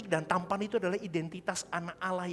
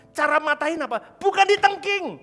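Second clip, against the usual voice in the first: loud high preacher's cry; fiery sermon shout; preaching at full force; 10 semitones higher, 9 dB louder than usual. Real, not acted. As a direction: thunder it out to the church.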